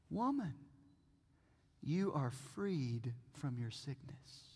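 soft, low and slow; quiet, unhurried pronouncement; hushed healing words. Acted. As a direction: speak gently, almost under your breath. Voices Jesus healing the woman.

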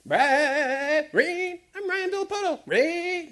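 high sung engine noise of a little boy playing, rain rain; child's motorcycle vroom. Preacher imitating little Johnny playing motorcycles, high singsong. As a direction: sing it like a kid revving a toy bike. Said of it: "he's like".

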